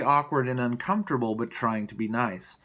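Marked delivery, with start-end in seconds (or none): none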